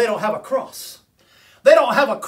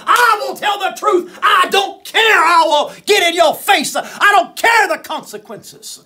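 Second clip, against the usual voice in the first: rising, louder pulpit preaching; fiery preaching; 7 semitones higher, 4 dB louder than usual. Acted. Preacher calling out the president, full pulpit force. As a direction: preach it hard to the congregation.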